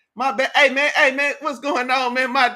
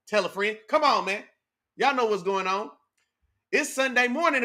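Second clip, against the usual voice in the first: normal talking voice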